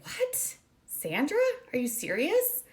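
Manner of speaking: stunned and disbelieving, pitch leaping up; shocked disbelief. Retold or imitated. Shocked reply on phone.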